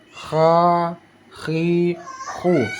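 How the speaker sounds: "Kha, khi, khu" with throaty friction; long rasped KH flowing through "Kha, khi, khu"; vibrating kha. Shown as a demonstration. Teacher showing the vibration, "Kha, khi, khu" rasped. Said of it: "dia seperti ada getaran".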